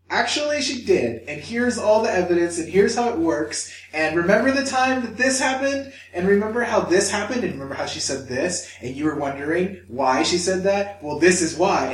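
high, loud, building list of evidence; acted-out told-you-so reveal; insistent inner voice. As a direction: lay out the proof point by point, emphatically. Acting out his brain presenting evidence.

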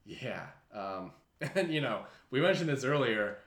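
normal talking voice

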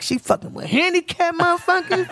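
high, laughing put-on voice of a jealous ex; mocking ex voice. Imagining her ex spotting her, high laughing mock voice. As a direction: squeal it out like the shocked ex. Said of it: "my ex will see me and be like".

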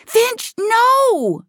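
high, desperate yell of protest; crying out. A narrator's reading. Girl cries out to stop Finch leaving, high alarmed cry. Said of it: "Mia cried".

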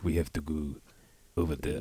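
low, soft lightly voiced movie villain; Kill Bill impression. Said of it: "that guy in Kill Bill".

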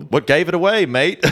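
high, hammed-up Aussie twang; a mock Australian asking what gave it away; bad Australian accent. Mocking a tourist in a bad Australian accent. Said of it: "You're doing the wrong accent".

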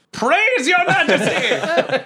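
excited cheer. Real, not acted. Cheering after natural twenty.

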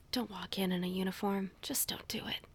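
soft conspiratorial whisper; hushed, secretive tip for auditioning actors. Real, not acted. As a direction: lean in and whisper the warning. Confiding advice to actors.

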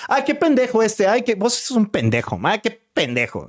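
put-on light Costa Rican accent, high and teasing. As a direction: tease like a Tico friend. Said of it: "Como en Costa Rica decimos". (acento tico, agudo y burlón)

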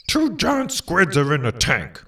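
ordinary talk, nothing marked

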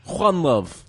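put-on loud, high Serbian accent, the name said as Juan. Co-host repeating the sign-off in a loud Serbian accent. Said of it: "I do the accent".

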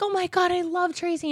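high-pitched imitation of an adoring fan; gushing fan. Acts out fans gushing to mom.

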